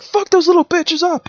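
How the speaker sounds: high mock angry yell; mock mob yell. Mob attacking the bees, high mock yell. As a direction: shout it like a furious mob. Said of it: "it's like".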